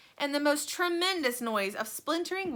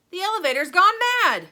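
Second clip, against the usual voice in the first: loud, high alarm; panicked shout; 10 semitones higher, 13 dB louder, faster than usual. A narrator's reading. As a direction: yell in fright. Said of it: "shouted Grandpa Joe".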